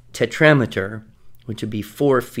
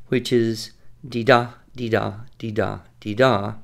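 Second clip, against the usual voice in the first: dee-da with the stress on da; sing-song dee-DA pattern; iambic rhythm. Shown as a demonstration. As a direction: chant the weak-strong beat. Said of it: "is a particular rhythmic pattern".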